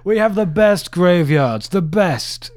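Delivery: hammed-up Trump brag, drawn out; Trump impression. Co-host imitating Trump bragging about graveyards, hammy and slow. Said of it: "Donald Trump impression".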